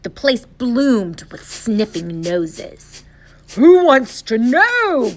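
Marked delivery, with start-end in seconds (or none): 3.6-5.1 loud, high, drawn-out growl; growled challenge. A narrator's reading. Voice inside the shack challenges the visitor, growling. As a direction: growl it out slowly and loudly. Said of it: "growled a voice from inside".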